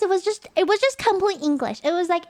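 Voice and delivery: ordinary talk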